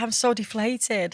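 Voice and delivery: soft, slow, sagging voice; mock deflated. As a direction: let the air out of every word. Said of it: "I was like".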